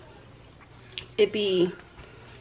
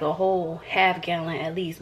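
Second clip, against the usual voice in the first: normal talking voice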